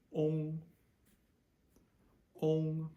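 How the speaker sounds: ONG with a long O, high and level; long O plus NG. Shown as a demonstration. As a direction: hold ONG on one high note, ending in NG. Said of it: "add an N G to it".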